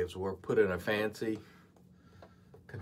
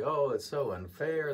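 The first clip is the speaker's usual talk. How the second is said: mock whine; 6 semitones higher than usual. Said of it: "cry and moan and say".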